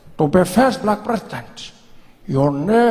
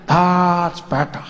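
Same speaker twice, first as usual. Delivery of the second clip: usual speaking voice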